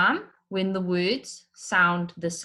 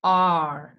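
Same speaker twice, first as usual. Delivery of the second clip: R said louder, held; end word stressed; 4 dB louder than usual. Shown as a demonstration. Teacher picking out the rhyming end word. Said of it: "the end words".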